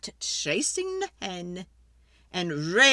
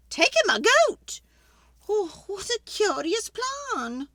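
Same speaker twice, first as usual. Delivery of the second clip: put-on high, loud complaining old lady; fretful old lady; 12 semitones higher, 5 dB louder than usual. Old lady begging the wise man for help, high and pleading.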